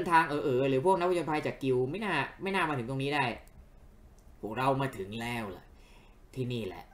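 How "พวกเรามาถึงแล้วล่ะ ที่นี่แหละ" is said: a hint of a game character inviting friends along; understated, lower-key character read; lightly voiced friendly host.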